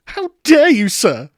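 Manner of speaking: How dare you shrieked high and very loud; hammed-up outraged shriek. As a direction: go over the top, scandalised. The outrage built up further.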